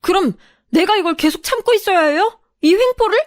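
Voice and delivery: high-pitched, defiant indignation. Acted. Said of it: "저 역시 목소리가 커졌죠".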